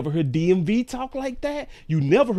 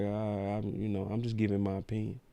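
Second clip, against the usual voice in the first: a soft, sheepish pundit excuse; mock whiny excuse; 5 semitones lower, 10 dB quieter than usual. Host mocks a pundit who never played, sheepish. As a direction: mumble it like a nervous guy caught out.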